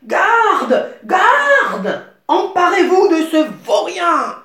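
very loud, high furious yell; shouting for the guards. Acted. The king orders the guards to seize the gardener, yelling. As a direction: call the guards at the top of your lungs. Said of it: "Puis il cria".

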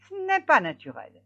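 high, loud protest; baffled hare. A narrator's reading. (lièvre incrédule, voix aiguë)

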